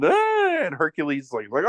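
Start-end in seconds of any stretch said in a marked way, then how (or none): 0.0-0.4 very high, short, hammed-up yelp; mock scream. Father mauled by the bear, hammed-up high scream. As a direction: squeal it like a man being mauled. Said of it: "he's screaming".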